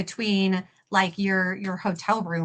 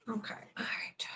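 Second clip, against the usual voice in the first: whispered to herself; 17 dB quieter than usual. Real, not acted. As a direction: murmur it under your breath. Whispering to herself while working.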